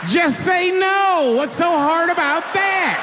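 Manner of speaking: very high, loud, slow preachy yell; mock PSA shout. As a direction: lecture them in a hammed-up shout.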